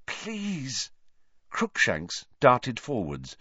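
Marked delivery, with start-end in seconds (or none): 0.1-0.9 frightened plea. A narrator's reading. Hermione pleading in fear.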